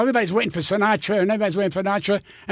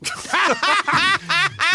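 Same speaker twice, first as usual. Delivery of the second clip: hearty laugh; 9 semitones higher than usual. Real, not acted. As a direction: burst out laughing at yourself. Laughs at own punchline.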